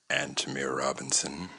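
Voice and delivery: slow, low imitation of a man's name drop; low, softly stretched impression; imitated voice. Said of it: "see if I can get his".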